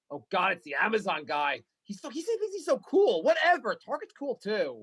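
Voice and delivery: high, slow, petty whine; jealous delivery guy. Pretend. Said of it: "What is the Target guy's like".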